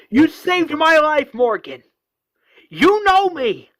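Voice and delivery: high, loud acted plea; impassioned yell at full voice; desperate shouting. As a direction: scream to make him recognise you. Rick pleading during the fight.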